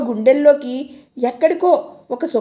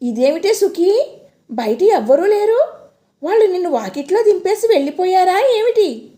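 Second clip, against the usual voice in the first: surprised question; 6 semitones higher than usual. Acted. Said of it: "ఆశ్చర్యంగా అడిగింది".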